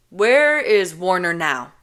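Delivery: slow, loud cutting-in question; slow, loud interrupt; curt interruption. Acted. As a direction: cut her off firmly. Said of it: "Kenji cuts me off".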